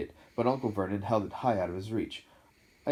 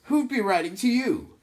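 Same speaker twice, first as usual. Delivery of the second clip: high, loud and scornful; sneering; 9 dB louder than usual. A narrator's reading. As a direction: sneer it down at the boy. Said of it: "sneered Uncle Vernon".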